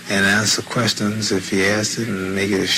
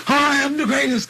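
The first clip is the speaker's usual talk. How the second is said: boastful shout. Retold or imitated. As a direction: crow the boast.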